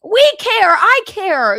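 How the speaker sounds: loud, high, indignant protest; mock-outraged yell for ballet; indignant shout. Real, not acted.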